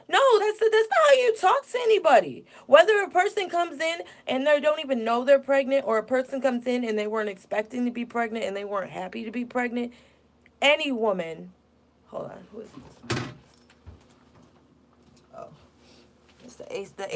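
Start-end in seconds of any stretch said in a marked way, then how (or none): 0.1-4.8 much higher and louder, furious; real outrage. Real, not acted. Ranting to viewers about a rude nurse, furious and loud. 12.1-17.1 quiet, breathy off-mic question; hushed aside. Real, not acted. Pausing the stream at a noise, hushed aside.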